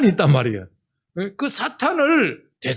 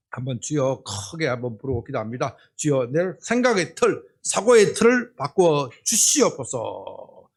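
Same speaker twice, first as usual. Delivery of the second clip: prayer leading; 4 semitones lower than usual. Acted. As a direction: lead the congregation into prayer. Leads congregational prayer.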